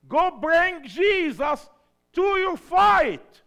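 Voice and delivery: very high, loud, slow exhortation; rally the church to bring God to their fight; full-force preaching. Acted.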